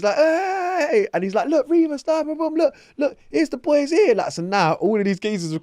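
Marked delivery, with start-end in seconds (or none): 0.2-1.1 very high, loud greeting shout; excited call. Retold or imitated. Sonny greeting his mates. 1.5-4.1 much higher, loud eager voice; imitating an excited friend. Sonny introducing the boys.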